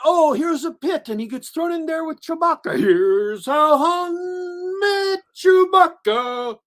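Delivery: very high, loud hammed-up announcement; over-the-top sarcastic wonder, ending drawn out; mock amazement. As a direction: build to a long, stretched-out fake-awed reveal. Mocks forced prequel origin moments.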